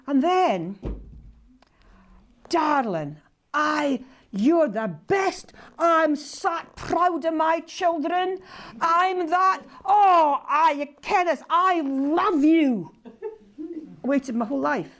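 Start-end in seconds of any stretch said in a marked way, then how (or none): 2.5-12.9 slow, high and loud with a laugh at the end; lightly voiced father overcome with love; emotional dad. Her dying father telling her he's proud, higher and emotional.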